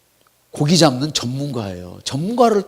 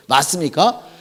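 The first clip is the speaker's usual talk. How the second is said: normal talking voice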